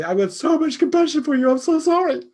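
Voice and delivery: high, loud, syrupy sympathy voice; mock gushing sympathy. Speaking to a wrecked car in mock sympathy. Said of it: "you wouldn't say".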